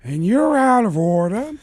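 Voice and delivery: rising Pacino courtroom rant; Al Pacino impression. Pacino among broken washers, rant starting to build. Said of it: "they cut to me as Al Pacino".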